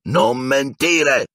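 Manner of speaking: high-pitched, slow drawn-out shout; angry yell. A narrator's reading. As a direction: yell it slowly, with fury.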